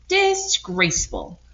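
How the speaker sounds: high, slow, scandalised hen; drawn-out scandalised cluck; disapproving hen. Acted. First hen condemning the pigs, high and slow.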